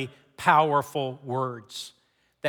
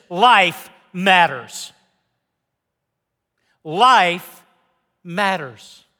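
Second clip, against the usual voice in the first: slow emphatic refrain; 2 semitones higher, 7 dB louder, much slower than usual. Acted. Sermon refrain, slowed.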